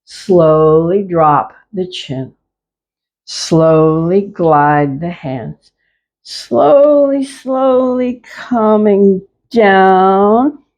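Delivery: slowed right down, each word stretched; slow motion. Shown as a demonstration. Teacher slowing the class's moves down, slow and drawn out. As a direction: guide the class at a crawl. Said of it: "Let's see if we can slow it down".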